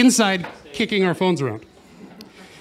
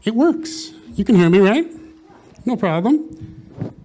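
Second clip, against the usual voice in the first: muffled behind a mask; speaking through face mask; 3 semitones higher, 5 dB louder than usual. Real, not acted. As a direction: talk through a face covering. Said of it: "I'm gonna try doing announcements with the mask on".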